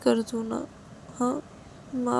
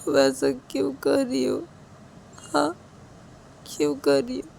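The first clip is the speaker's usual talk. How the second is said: tearful pleading; 6 semitones higher than usual. A narrator's reading. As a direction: plead through tears, voice wavering high. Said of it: "Siya की आंखों से आंसू गिरने लगते हैं". (रोते हुए गिड़गिड़ाना)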